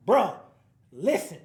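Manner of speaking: loud blunt call to get his attention; fed-up shout. Retold or imitated. Fed-up friend calling out his heartbroken buddy, loud. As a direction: bark it out to snap your boy out of it. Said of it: "You be like".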